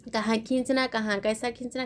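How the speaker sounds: ordinary talk, nothing marked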